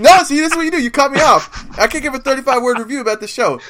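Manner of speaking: high, indignant complaint; fed up at being interrupted; exasperated. Real, not acted. Annoyed at being cut off.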